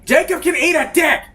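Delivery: loud, high-pitched outburst; sudden scream. Real, not acted. Player yelling back at the game about Jacob, screamed. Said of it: "I don't know why I screamed that".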